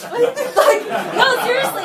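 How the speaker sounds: high, loud laughing protest. Real, not acted. Preacher telling the congregation she's not called to people, laughing loudly. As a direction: laugh it out, really meaning it. Laughing at her own confession.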